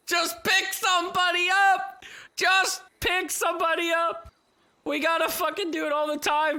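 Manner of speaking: frustrated shout. Real, not acted. Frustrated repeated demand to teammates.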